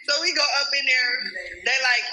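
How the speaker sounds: ordinary talk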